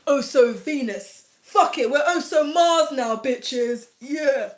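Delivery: slow, lightly mock-manly intro voice; hammed-up bloke welcoming viewers; mock man vlogger. Joking about rebranding her channel as a man, mock manly greeting. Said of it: "let me just go and draw a moustache".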